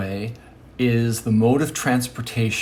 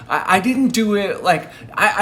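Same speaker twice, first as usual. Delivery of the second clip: ordinary delivery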